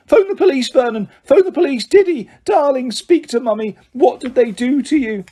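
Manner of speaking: high, loud panicked screech; screaming. Acted. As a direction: shriek for the police in a panic. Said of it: "Aunt Petunia screamed".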